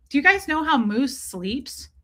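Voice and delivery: usual speaking voice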